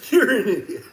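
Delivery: a high, loud laughing jeer; loud scoff. Real, not acted. Jeering at the woke community, loud and laughing. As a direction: throw the insult out with a laugh.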